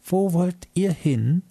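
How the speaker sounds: put-on high, slow, curious little girl's question; a small girl asking eagerly in a squeaky voice. A narrator's reading. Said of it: "mit neugieriger, piepsiger Stimme".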